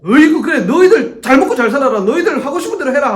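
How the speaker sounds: loud, fed-up sigh of giving up on them; exasperated giving up. Retold or imitated.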